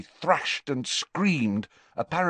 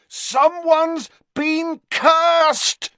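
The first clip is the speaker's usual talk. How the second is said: slow, high, loud bellow; desperate bellow to a giant friend; 6 dB louder, much slower than usual. Acted. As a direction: drag the words out over the roaring wind. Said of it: "bellowed Harry".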